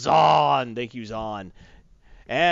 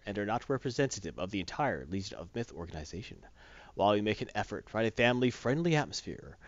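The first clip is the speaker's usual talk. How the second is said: low, soft measured announcement; formal disclaimer read; 5 semitones lower, 4 dB quieter than usual. Real, not acted. Host reading the show's disclaimer, low formal read. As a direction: read the legal notice evenly and formally.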